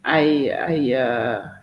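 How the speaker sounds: usual speaking voice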